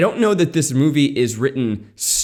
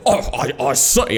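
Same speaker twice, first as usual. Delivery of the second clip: botched British dad turning cartoon Southerner; Yosemite Sam. Host imitating the movie's dad, slipping into Southern cartoon voice. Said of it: "Yosemite Sam for that".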